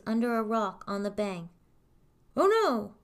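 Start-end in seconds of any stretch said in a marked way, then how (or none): 2.4-2.9 high, loud cry of alarm; cat crying out in alarm; alarmed yell. A narrator's reading. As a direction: yell it out in dismay. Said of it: "Puss in Boots would yell".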